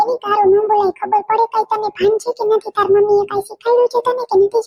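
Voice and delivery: put-on a bossy girl telling off her cousin; scolding little girl. Little cousin scolding the other girl for name-calling.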